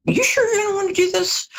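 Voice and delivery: doubting voice, jumping high on the repeat; questioning universe. Acted. The universe testing someone's choice, high-pitched questioning. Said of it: "the universe comes back once or twice and says".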